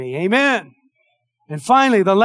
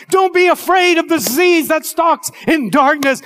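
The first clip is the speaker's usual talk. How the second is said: high, loud preaching voice; fiery preaching; 9 semitones higher, 5 dB louder than usual. Acted. Preacher commanding the church not to fear, fiery. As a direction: preach it out to the whole congregation. Opening the sermon at full force.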